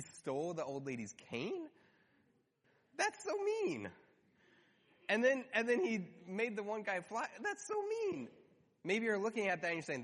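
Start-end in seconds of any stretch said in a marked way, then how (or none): 3.0-3.9 squeaky, much higher mock pout; mock outrage. Mock outrage at Grinch film. 7.4-8.3 squeaky, much higher mock pout; mock outrage. Mock outrage at Grinch film.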